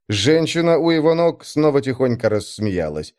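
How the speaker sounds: ordinary delivery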